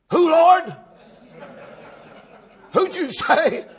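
high, loud double take; startled disbelief. Acted. Ananias questioning the Lord about Saul of Tarsus, in startled disbelief. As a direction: balk loudly at the name you just heard. Ananias balks at hearing Saul's name.

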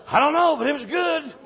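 high, loud, impressed answer; excited reply. Retold or imitated. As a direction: answer your amazed friend. Preacher answering his friend.

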